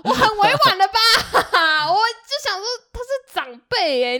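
laughing hard, high and loud; cracking up while telling it; real laughter through the words. Real, not acted. Laughing at own story.

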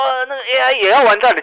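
mock doomsayer. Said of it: "就会有人说".

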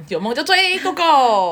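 drawn-out, rising rally cry; a cheerleader's go go; cheering. Real, not acted. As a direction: pump the listeners up, slow and bright.

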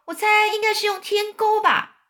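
high, jeering centipede voice; mocking. A narrator's reading. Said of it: "蜈蚣在一旁很嘲笑地说".

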